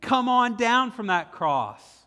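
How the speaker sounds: high, loud, slow taunt; loud mocking slow jeer; jeering passerby. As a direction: dare him to come down, scornfully. Passersby deride Jesus on cross.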